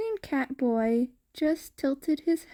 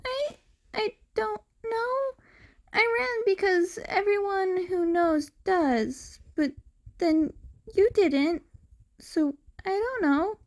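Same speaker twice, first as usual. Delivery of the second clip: put-on high boy voice, hesitant and stammering; unsure cat boy; 8 semitones higher than usual. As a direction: fumble for the answer.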